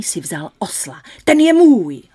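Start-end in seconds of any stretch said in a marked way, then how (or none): 1.2-2.0 loud high cry; exclaimed. Acted. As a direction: shout it out to grab the donkey.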